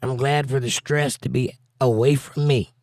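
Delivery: ordinary delivery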